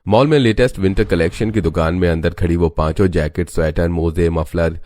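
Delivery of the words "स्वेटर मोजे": ordinary delivery, nothing marked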